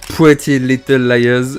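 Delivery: slow, exaggerated English accent on "Pretty Little Liars", said loud and drawn out; a French speaker hamming up an English accent; bad English accent. Shown as a demonstration. French vlogger announcing the next series in a clumsy English accent. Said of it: "mon accent anglais qui est absolument catastrophique".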